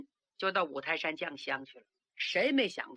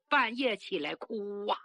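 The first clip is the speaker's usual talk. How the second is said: plain reading voice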